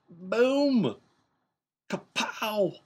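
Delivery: loud, high, bursting "Boom! Kapow!"; excited outburst. Retold or imitated. Dad telling friends his excitement at her answer, loud and high. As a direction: explode with delight at her answer.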